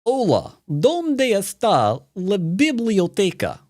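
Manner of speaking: Spanish in a mock Russian accent. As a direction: put on a comic Russian accent for "¿dónde está la biblioteca?". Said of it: "Spanish in a Russian accent".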